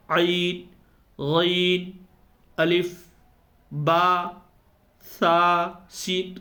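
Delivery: عین، غین، الف، با، سا، سین said slowly as separate, drawn-out letter names; letter sounds demonstration. Shown as a demonstration. Teacher showing students how different sounds differ, عین، غین، الف drawn out one by one. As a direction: stretch عین، غین and سین on long held vowels, a pause after each letter. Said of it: "اس طرح جب بولیں گے".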